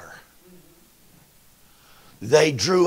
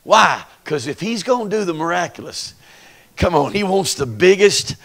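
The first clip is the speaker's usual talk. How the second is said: normal talking voice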